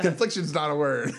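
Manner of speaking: can't keep a straight face; laughing quote. Retold or imitated. Retelling the rival's message, laughing. Quoting the guy's Grindr note, laughing.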